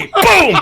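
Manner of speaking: high-pitched falling "boom"; imitated impact. Storyteller imitates chair hitting head, imitated impact. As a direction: voice another blow of the chair.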